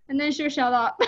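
ordinary talk

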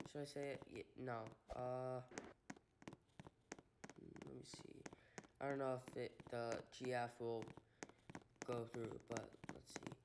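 ordinary talk

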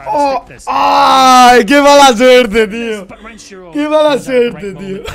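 loud, drawn-out groan of bad luck; a real pained shout at the screen; frustrated outcry. Real, not acted. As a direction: cry out at the unlucky play, stretching it slowly. Bad luck moment in game.

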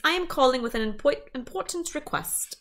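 quick, high British caller; British accent. A narrator's reading. Detective phoning with a request, brisk British accent. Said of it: "It also had an accent".

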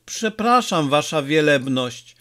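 plain reading voice

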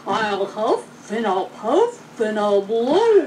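slow, drawn-out huffing threat; wolf threat. A narrator's reading. Wolf threatens the brick house, slow and drawn out. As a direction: drag out each huff and puff. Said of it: "a line I said in the classic short".

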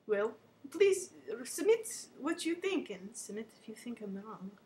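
ordinary talk, nothing marked

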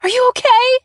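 high, loud worried call; frantic shout. A narrator's reading. Narrator shouts to Harry while running, panicked. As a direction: yell out in fear for him.

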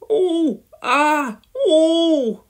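high, loud hammed-up moans; three theatrical wails of pain; mock-pained wailing. A narrator's reading. Elvis acts the casualty while being bandaged, wailing. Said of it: "wailed Elvis".

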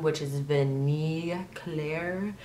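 slow, careful French accent. Real, not acted. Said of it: "My French accent has gotten awful".

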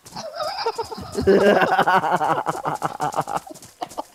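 a long helpless laugh; laughing hard at the joke; long real laugh. Real, not acted. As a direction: burst out laughing.

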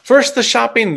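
ordinary delivery

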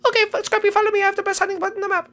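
very high squeaky little voice; high-pitched game character. Acted. Character telling Scrappy to follow to a hiding spot, squeaky.

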